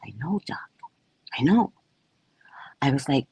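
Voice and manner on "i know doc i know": hushed, breathy plea to the doc; whispered plea. Retold or imitated. Patient whispering to her doctor, pleading. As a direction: whisper it like a guilty confession.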